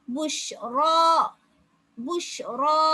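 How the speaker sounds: புஷ்ரா called out with a rising end; recitation. Shown as a demonstration. Teacher chanting the correct reading for students. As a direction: chant புஷ்ரா strongly, ending on the long ா. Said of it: "என்று தான் நாங்க ஓதணும்".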